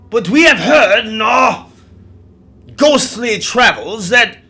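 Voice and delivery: put-on loud, indignant old English colonel; old English accent. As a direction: rail in old English, high and forceful. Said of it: "in his old English accent".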